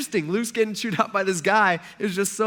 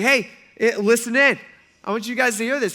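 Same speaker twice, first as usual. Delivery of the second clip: louder and higher, summoning a crowd; calling out; 6 semitones higher, 6 dB louder than usual. Retold or imitated. Jesus calling the crowd over, louder and higher. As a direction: call everyone over to listen. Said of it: "he's like, he's like".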